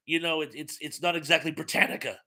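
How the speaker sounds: usual speaking voice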